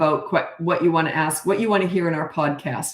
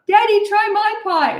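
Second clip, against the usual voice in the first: put-on high, slow, eager child's plea; very high little-girl voice begging dad to taste; piping little girl; 6 dB louder than usual. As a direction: pipe up like a small proud girl. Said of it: "I would pipe up".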